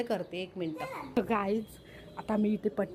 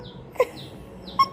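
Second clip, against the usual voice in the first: stifled giggle. Real, not acted. Hiding from searching puppy.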